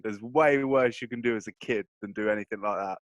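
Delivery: normal talking voice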